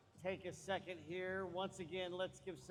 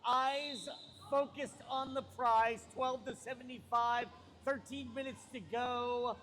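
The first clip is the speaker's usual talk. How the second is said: loud, drawn-out arena announcer call; sports announcer; 4 semitones higher, 7 dB louder than usual. Acted. Announcer setting up the jam, big projected call. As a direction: punch the key words and stretch them.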